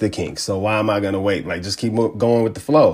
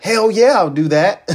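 higher-pitched, excited yes; eager agreement; 9 semitones higher than usual. Retold or imitated. Retelling his reply to the Face ID offer, eager. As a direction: re-enact jumping at the offer. Retold eager reaction to new feature.

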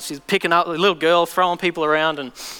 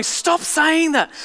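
higher, slow pleading demon voice; 8 semitones higher, much slower than usual. Retold or imitated. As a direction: drag it out, in pain.

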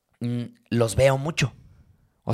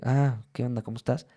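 friendly greeting; 2 semitones lower, 7 dB quieter than usual. Retold or imitated. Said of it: "sino como que".

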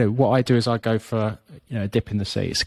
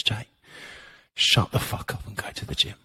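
a softer, breathy whisper of the inner voice; 6 dB quieter than usual. Retold or imitated. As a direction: whisper it to yourself as the voice in your head. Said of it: "this second voice comes into my head and goes".

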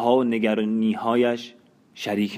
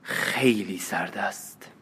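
slow, softened spiteful reply; understated spite; 2 semitones lower, 5 dB quieter, slower than usual. A narrator's reading. Bompar answers about Russia's weather, with restrained spite. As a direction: draw it out quietly with a touch of rancour.

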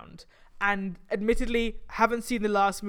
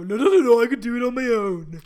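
stubborn guy attitude; 7 dB louder than usual. Retold or imitated. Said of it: "such a guy attitude".

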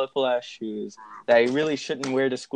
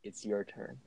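low, hushed, solemn sage; deep, soft mock-guru voice; Morpheus-Yoda mixture; 4 semitones lower, 15 dB quieter than usual. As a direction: intone it quietly like a wise mentor. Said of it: "sounded like a mixture of Morpheus from The Matrix and Yoda".

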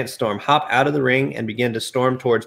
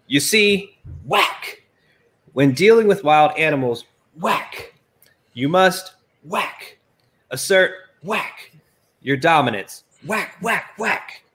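Blackman whacking; 5 semitones higher than usual. Acted. Blackman beating, voicing whacks.

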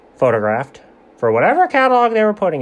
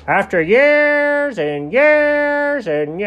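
slow, drawn-out speechifying; pompous salesman milking the moment; dragging it out; 8 semitones higher, much slower than usual. Retold or imitated. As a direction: stretch every word like a windbag. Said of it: "Come on, buddy, get on with it".

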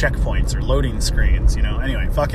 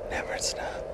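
soft breathy whisper; 14 dB quieter than usual. Real, not acted. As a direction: whisper it tenderly. Repeats it in a whisper.

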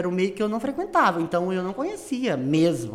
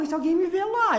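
mock worried; 10 semitones higher than usual. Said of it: "retold worried reply".

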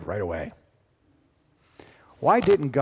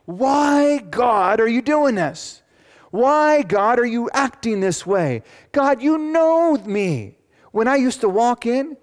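much higher, louder pleading cry to God; frustrated Job; 12 semitones higher, 9 dB louder, slower than usual. Acted. Job crying out in frustration at God. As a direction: cry out like a man at the end of his rope. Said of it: "Seriously saying".

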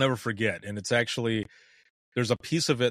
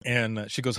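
ordinary talk

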